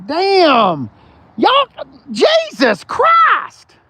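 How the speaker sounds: high, loud, drawn-out cry of disbelief; shocked outcry. Real, not acted. Dealer stunned by the car's shape, shouting. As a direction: recoil at the car's looks.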